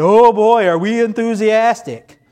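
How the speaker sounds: loud, high mock cheer with a laugh; sarcastic. Teen at a chant mocking it with a sarcastic laughing cheer. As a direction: fake enthusiasm, dripping sarcasm. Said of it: "sarcasm implied".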